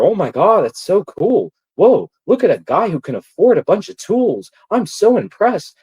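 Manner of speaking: high, loud gushing mock awe; mock fawning. Girls admiring Bird's tools, a high loud mocking gush. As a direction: coo like a starstruck fan. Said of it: "you got these bitches over there".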